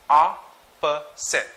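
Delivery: Opposite very slowly as "ah, puh, sit"; slow teacher's demo of Opposite with gaps; syllabicated. Shown as a demonstration. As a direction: break "Opposite" into three syllables. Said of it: "Let's try to syllabicate".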